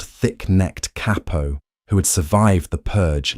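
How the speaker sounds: usual speaking voice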